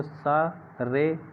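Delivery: "सा रे" drawn out very slowly; slow teaching drawl; slow mnemonic. Shown as a demonstration. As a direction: break the mnemonic "सा रे" into syllables for the class. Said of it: "कैसे कैसे हैं आसान?".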